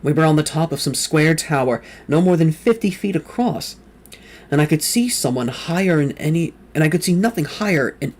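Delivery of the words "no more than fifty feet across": ordinary delivery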